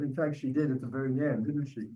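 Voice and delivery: usual speaking voice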